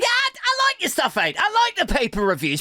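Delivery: mock fan voice, high and chirpy; voiced internet fan. Comedian voicing the internet as a gushing fan, mock high. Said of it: "the entire internet is like".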